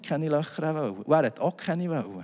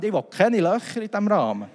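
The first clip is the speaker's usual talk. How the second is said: higher, louder, breaking into a laugh; louder insistence; 7 semitones higher, 5 dB louder than usual. Retold or imitated. To the installer, a louder laughing refusal. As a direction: push the refusal harder, laughing. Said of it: "Dann kann er sagen".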